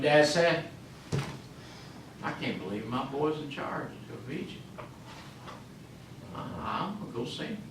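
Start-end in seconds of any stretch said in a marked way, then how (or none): 2.2-7.6 very soft, lower voice; hushed disbelief. Acted. Old dad hears his boy runs Egypt, hushed disbelief. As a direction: drop right down, quietly taking it in. Preacher voices father hearing the news.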